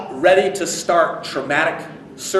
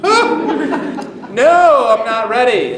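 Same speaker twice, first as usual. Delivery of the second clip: startled refusal; 11 semitones higher, 8 dB louder, much slower than usual. Retold or imitated. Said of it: "I was like".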